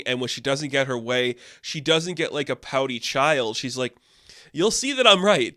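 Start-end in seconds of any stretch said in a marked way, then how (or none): 4.5-5.6 higher, louder mock self-assurance; confident retort. Demanding woman to the man she controls, confidently. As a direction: imitate a woman sure she'll win. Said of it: "so confident in everything".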